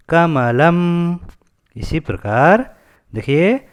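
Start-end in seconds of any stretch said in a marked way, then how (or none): 0.1-1.1 Kamalam drawn out syllable by syllable; teacher saying Kamalam very slowly for the class to copy; slow model pronunciation. Shown as a demonstration. Teacher to students, modelling the word Kamalam, very slow. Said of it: "तो इसको हम कहेंगे".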